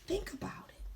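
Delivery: whispering. Real, not acted. Whispered prompt to children.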